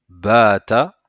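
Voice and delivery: "Baata" loud, with a long first vowel; model pronunciation. Shown as a demonstration. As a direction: say "Baata" as one word for learners to repeat, first "a" held. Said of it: "essaye de répéter avec moi". (« Baata » fort, modèle à répéter, premier « a » long)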